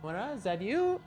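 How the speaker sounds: high, soft pet-talk voice; cooing to the cat. Real, not acted. Streamer coos softly to her cat entering the room. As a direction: coo gently at the cat who wandered in.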